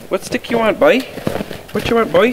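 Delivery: high, sing-song voice for the dog; sweet high coaxing for the dog; dog baby talk. Acted. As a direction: coo at the pup like a toddler.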